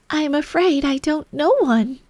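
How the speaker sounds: high, tense and alarmed. A narrator's reading. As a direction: blurt it out, alarmed. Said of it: "rather alarmed at the proposal".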